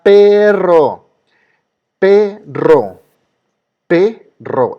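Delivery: perro with PE loud, high and drawn out; stress on first syllable. Shown as a demonstration. Teacher asking students to listen, stressed PE in perro. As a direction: split perro in two, punching PE. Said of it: "la que suena más fuerte es la P".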